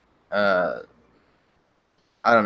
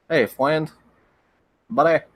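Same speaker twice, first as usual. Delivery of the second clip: higher, chummy mock Canadian greeting; mock Canadian accent; 6 semitones higher, 4 dB louder than usual. Streamer hamming a Canadian greeting to chat. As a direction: greet like a cartoon Canadian, pitched up.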